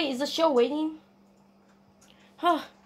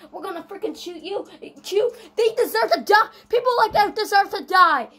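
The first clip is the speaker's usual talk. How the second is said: raised, louder mock-outraged rant; hammed-up angry rant; 4 semitones higher, 5 dB louder than usual. Gamer to the players shooting him, loud hammed-up anger. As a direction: rant loudly at the people attacking you.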